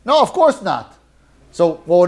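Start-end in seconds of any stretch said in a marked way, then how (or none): 0.0-0.9 mock no. Shown as a demonstration. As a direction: say "No, of course not" as a no that means yes. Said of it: "no can mean yes".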